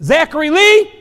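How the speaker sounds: normal talking voice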